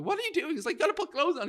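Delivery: high, loud incredulous scolding; shocked telling-off. Retold or imitated. Comedian scolding the naked man, high incredulous scold. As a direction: squeak it out, aghast.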